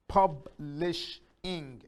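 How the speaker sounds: PUBLISHING slowly as pub, lish, ing; three separate beats in publishing; split into syllables. Shown as a demonstration. Teacher showing three vowels in publishing, split into syllables. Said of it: "three syllables".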